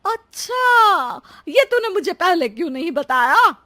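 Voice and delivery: astonished realisation. Acted. Mother-in-law discovering video calls and chiding daughter-in-law, astonished. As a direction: stretch the first word high, then scold.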